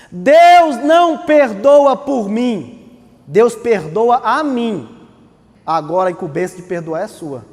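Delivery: ordinary delivery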